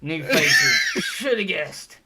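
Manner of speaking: loud, high in-character grumble; exasperated shout. Acted. Guard eyes the new faces downstairs, loud exasperation. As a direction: throw it out loudly at the newcomers. Guard reacts to strangers, acted.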